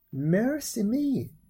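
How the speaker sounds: put-on high, drawn-out old woman's exclamation; old woman exclaiming. A narrator's reading. Old woman at the baby found inside the peach, high drawn-out exclamation. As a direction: stretch it out high in wonder. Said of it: "said the old woman".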